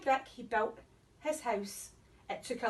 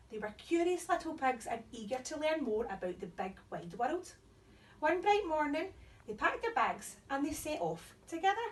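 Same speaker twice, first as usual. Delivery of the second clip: bright storytime voice; cheerful reading to children; overbright kids party host; 3 dB quieter than usual. Acted. Reading to children, intro and opening.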